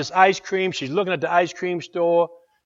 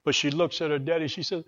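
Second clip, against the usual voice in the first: ordinary delivery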